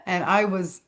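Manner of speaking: ordinary talk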